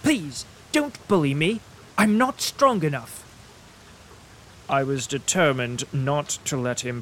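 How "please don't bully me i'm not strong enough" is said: higher, whining "Please, don't bully me"; weak invalid's plea, higher than the first "Don't bully me!"; helpless plea. Acted. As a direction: plead feebly as if too weak to argue. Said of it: "falling back helplessly".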